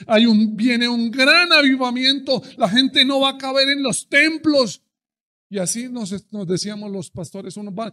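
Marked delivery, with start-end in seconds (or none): 0.1-4.8 higher, much louder triumphant claim; excited prophecy. Retold or imitated. Members announcing great revival to pastors, loud excited. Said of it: "los hermanos nos dijeron".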